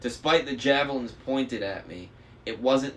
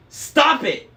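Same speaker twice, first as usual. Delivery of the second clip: loud, much higher scream; shouted; 10 dB louder than usual. A narrator's reading. Annabeth screaming at Luke to stop. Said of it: "she shouted".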